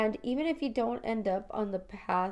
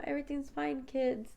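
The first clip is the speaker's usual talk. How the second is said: mock bright, higher and a touch softer; fake cheerful; 5 semitones higher, 3 dB quieter than usual. Mom pretending to her children that all is fine, fake cheerful. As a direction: put on a fake smile for the kids. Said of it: "smiling and pretending".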